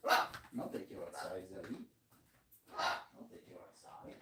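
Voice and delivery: soft hushed words to the dog; gentle hushed promise to a puppy; hushed pet talk. Real, not acted. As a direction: murmur it low to the pet. Whispering to pet.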